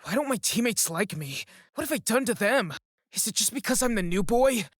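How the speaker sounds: loud, high-pitched anger, building; angry. A narrator's reading. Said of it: "My self-doubt turned to anger".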